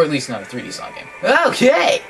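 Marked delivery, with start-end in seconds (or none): 1.5-2.0 gleeful whee. Real, not acted. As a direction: whoop it up like a rollercoaster. Thrilled at speeding ride.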